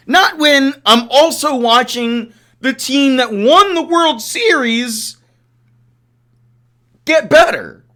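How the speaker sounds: heated rant. Real, not acted. Fan host ranting to chat about the rival teams, heated. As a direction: vent your frustration at the rival team's gains. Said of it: "I did my rant".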